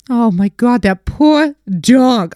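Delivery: mom's gasp of pity. Retold or imitated. Mom walking in on the grooming, high slow pity. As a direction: play the shocked, pitying mom. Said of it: "My mom comes in".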